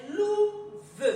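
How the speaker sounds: "louve" slowed, loud "lou", gap, then "ve"; long "lou" and separate "ve" in "louve"; syllable by syllable. Shown as a demonstration. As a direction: sound out "louve" as two distinct syllables. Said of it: "on le lit syllabe par syllabe".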